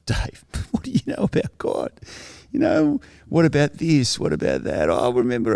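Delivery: high mocking party jeer, half whispered; mocking partygoers. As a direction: tease him like the party crowd laughing at him. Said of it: "people started to say".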